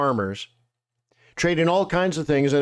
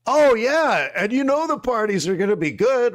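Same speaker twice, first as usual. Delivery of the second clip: excited whoop; 7 semitones higher than usual. Retold or imitated. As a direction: hype it up like a party's coming.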